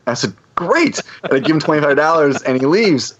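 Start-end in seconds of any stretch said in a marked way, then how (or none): 0.5-1.0 high, bright-eyed "Great."; pleased "great". Retold or imitated. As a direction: sound genuinely delighted. Speaker's own pleased reply.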